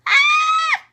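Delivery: piercing high shriek; loud high terrified shriek. Acted. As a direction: shriek in terror. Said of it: "shrieked Flap".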